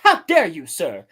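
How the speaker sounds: hammed-up indignant rebuke; imitated indignant scolding. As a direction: act out an affronted, pompous politician. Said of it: "the way that he talks to people".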